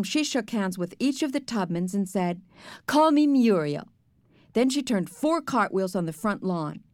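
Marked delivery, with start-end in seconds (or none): 2.9-3.9 high, loud announcement; hearty introduction. A narrator's reading. Grandmother introduces herself to neighbours.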